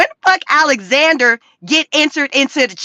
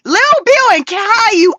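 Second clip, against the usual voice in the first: incredulous; 8 semitones higher, 3 dB louder than usual. Real, not acted. Disbelief at thumbnail.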